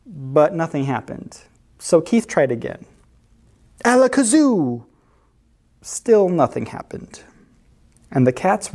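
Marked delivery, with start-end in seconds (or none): 3.9-4.8 theatrical spell-casting; magician's incantation. A narrator's reading. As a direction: cast the spell grandly, pitched up. Cat tries another magic spell.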